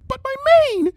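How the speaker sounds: whining reflection. Acted. Reflection whines.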